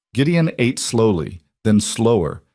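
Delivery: ordinary delivery, nothing marked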